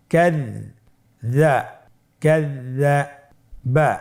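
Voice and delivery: ক্যাযযাবা drawn out syllable by syllable; slow spelled-out reading. Shown as a demonstration. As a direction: stretch ক্যাযযাবা slowly, each sound held. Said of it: "আমার সাথে বলুন". (ধীরে টেনে ক্যাযযাবা উচ্চারণ)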